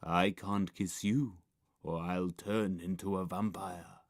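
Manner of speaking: low, subdued film hero; scowling boy. A narrator's reading. Bandaged boy refusing pale girl, scowling. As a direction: grumble it low and gloomy. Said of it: "scowled the boy".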